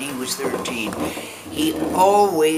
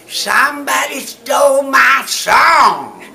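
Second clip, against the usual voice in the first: big high yell that tops the singing; yelled over it; 13 semitones higher, 13 dB louder, slower than usual. Retold or imitated. Stage actress yelling over a rival's song, big comic shout. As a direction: bellow it out to steal the stage back. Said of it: "I just yelled over it".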